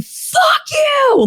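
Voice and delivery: high, shouted fuck you into the night; screaming. Retold or imitated. A girl nearly run over, screaming at the fleeing driver. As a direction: scream it after him at full pitch. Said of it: "I remember screaming".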